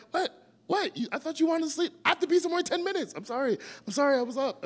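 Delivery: high, fast, rushed sorries; husband hurriedly apologizing to his annoyed wife; flustered apology. Retold or imitated. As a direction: scramble to apologize in a hurry.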